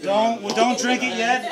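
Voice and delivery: loud sudden call to stop them. Real, not acted. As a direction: raise the voice to stop the room. Host halts the toast crowd.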